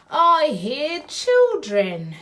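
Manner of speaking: excited storytime build. Pretend. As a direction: announce it big to kids. Big opening of finale.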